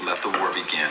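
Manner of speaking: soft ominous repeat of let the war begin; quiet echo. Acted. Repeating his war cry to the viewers, soft and ominous. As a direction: drop it low and soft, ominous.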